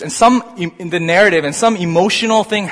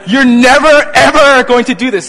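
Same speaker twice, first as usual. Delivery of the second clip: high, loud outraged rebuke; caps lock shout; 7 semitones higher, 9 dB louder than usual. Shown as a demonstration. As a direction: shout it like an all-caps text.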